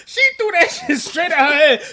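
normal talking voice

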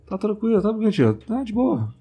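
high-pitched, held-in reassurance; holding back tears. Retold or imitated. Reassuring ex's mother that all is fine, holding back. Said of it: "E eu me segurando".

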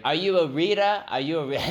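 high, loud mock British question; quizzing in a put-on British accent. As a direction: imitate a baffled British agent. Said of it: "He was British".

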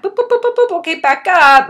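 high, loud "boop boop" backtracking noise; mock stumbling babble. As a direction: rattle off high-pitched boops, then back up loudly. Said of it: "you know, where I went".

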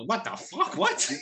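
high-pitched disbelief, rising at the end; incredulous. Real, not acted. Storyteller reacting to being named in a story, incredulous. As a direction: squeak out your disbelief.

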